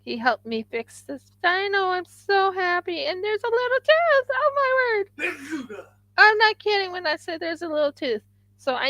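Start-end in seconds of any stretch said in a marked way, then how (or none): 1.4-8.2 much higher thrilled voice; excited high pitch. Real, not acted. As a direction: gush with delight, pitch climbing. Thrilled crafter showing her work.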